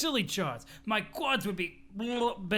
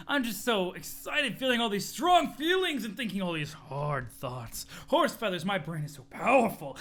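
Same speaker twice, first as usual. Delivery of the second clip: a gushing gym bro who is thrilled to be alive; excited muscle bro. Acted. Muscle bro raving to Dirk about strong feelings. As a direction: gush about the thrill of being strong, laughing.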